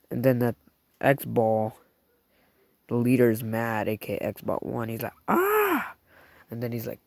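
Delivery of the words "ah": angry yell. Acted. Mad leader shouting.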